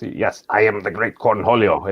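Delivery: slow put-on cartoon voice; slow imitation of a cartoon teen's alter ego; Cornholio. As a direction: ham up the cartoon character's grand declaration. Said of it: "influenced by Beavis and Butthead".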